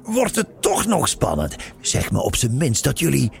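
high, excited interruption; calling out eagerly. A narrator's reading. Frank hoping the story gets exciting, eagerly exclaiming. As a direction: butt in, hungry for juicy details. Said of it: "riep Frank".

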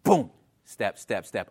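normal talking voice

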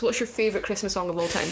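ordinary delivery

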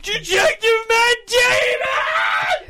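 very high, loud, drawn-out cry; mother crying out at the detective; anguished shout. Retold or imitated. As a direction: wail his name in outrage. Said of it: "I was like".

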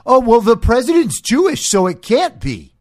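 high, sneering mock voice; mocking quote. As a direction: parody the opponents' lame excuse. Mocking opponents' argument.

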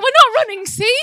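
high, loud, playfully frantic; mock panicked protest. Couple fast-walking away from a bear, mock panicked.

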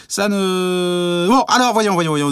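exasperated outburst. Acted. Exasperated mayor cuts short.

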